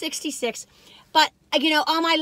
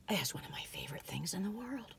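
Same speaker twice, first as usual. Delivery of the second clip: soft, breathy confiding whisper; hushed whisper; 6 semitones lower, 18 dB quieter than usual. Real, not acted. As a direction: share it like a secret treat. Confiding about maple candies.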